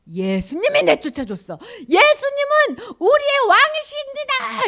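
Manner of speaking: acted shout rising to a high pitch; loud proclamation. Healed man preaching to townsfolk, loud proclamation. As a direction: build to a drawn-out, triumphant herald's cry.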